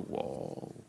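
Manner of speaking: breathless whispered wow; a hushed, amazed wow; awed whisper. Real, not acted. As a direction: whisper it, struck by the verse. Awed reaction to the verse.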